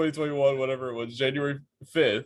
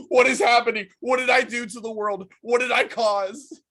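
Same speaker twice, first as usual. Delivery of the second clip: high-pitched alarmed wail, louder at first; mock panic; 12 semitones higher, 4 dB louder than usual. [name] reliving shock at the news, mock panic. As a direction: cry out in exaggerated disbelief.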